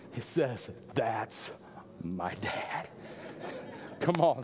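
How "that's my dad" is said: much softer and lower, hushed with awe; awed pride. Retold or imitated. Son proudly watching dad defend him, hushed. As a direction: breathe it like a proud little boy.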